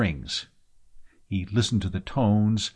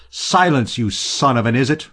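loud, higher angry sailor's roar; 4 semitones higher, 6 dB louder than usual. A narrator's reading. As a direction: roar the order at an insolent underling. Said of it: "roared the sailor".

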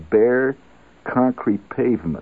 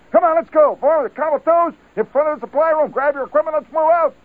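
high, loud barking orders; first sergeant marching the men to supply; drill sergeant; 5 dB louder than usual. Retold or imitated. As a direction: command the platoons like a frantic first sergeant.

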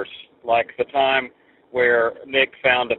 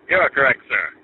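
Ed McMahon. Retold or imitated. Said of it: "So here's the Ed McMahon laugh".

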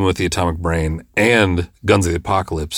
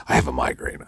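lower, softer, limp sickly voice; mock feeble excuse; 7 semitones lower, 7 dB quieter than usual. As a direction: fake a weak sick-day excuse. Mocking the actor's excuse.

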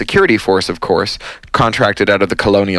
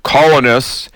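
amplified booming call; 5 semitones higher, 3 dB louder, slower than usual. A narrator's reading. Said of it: "her voice so loud".

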